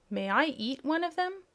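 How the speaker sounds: high, begging kitten voice; pleading kitten. A narrator's reading. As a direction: beg sweetly like a hungry kitten. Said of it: "asked the kitten in a pleading voice".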